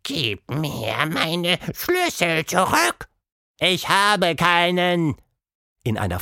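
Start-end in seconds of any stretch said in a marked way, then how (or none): 0.0-3.1 growl. A narrator's reading. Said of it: "Quatschmonster knurrte". 3.6-5.2 slow, higher panting mouse voice; a broken-speaking little mouse. A narrator's reading. The breathless mouse to its captors, slow mouse voice.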